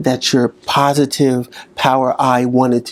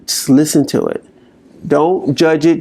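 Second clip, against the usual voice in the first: ordinary talk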